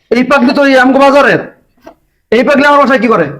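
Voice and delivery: angry father. Acted. Father finds madwoman inside, calls out.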